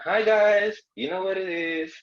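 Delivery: high, drawn-out teasing impression of a presenter's greeting; mock radio host. Coach teases host about her recording voice, mock presenter. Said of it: "you press record and like".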